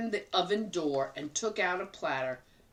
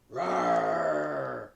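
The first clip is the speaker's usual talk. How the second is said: bear growl; 3 semitones lower than usual. Acted.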